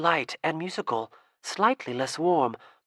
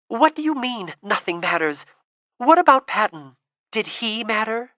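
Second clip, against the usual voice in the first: much higher, loud, biggest on Patton; demanding; 7 semitones higher, 7 dB louder than usual. Acted. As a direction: fire questions in rising anger. Girl demands answers from Lena.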